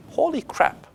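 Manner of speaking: high, amazed outburst; surprised exclamation. Real, not acted. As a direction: blurt it out in disbelief. Reacting to surprising result.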